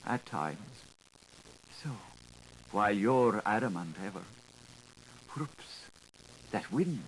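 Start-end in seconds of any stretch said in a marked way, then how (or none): 5.3-5.8 breathy little whoops; startled unvoiced whoops; whispered whoops. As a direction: catch the breath at the sudden gust. Sudden gust of wind.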